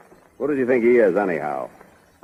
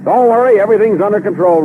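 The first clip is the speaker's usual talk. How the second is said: cocky; 8 semitones higher, 5 dB louder than usual. Acted. Speeding driver boasts over engine.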